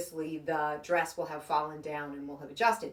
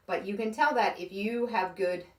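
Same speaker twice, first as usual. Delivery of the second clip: ordinary talk, nothing marked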